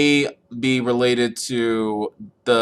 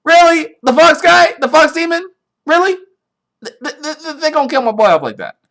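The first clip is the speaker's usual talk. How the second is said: much higher and louder, stammering in mock disbelief; outraged disbelief; 7 dB louder than usual. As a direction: squeal it like your favourite just got killed off. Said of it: "I'm like".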